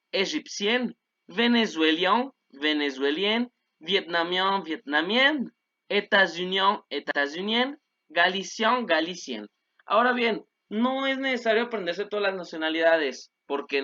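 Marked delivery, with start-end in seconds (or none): none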